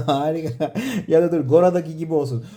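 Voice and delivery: ordinary delivery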